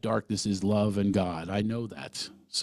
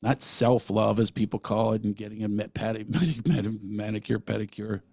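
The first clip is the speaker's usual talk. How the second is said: normal talking voice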